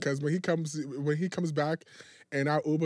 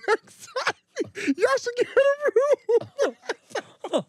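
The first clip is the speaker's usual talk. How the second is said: high, slow, laughing mock of the model; laughing through the quote; 5 dB louder, much slower than usual. Retelling the model's tease while laughing. Model teasing them in bathroom.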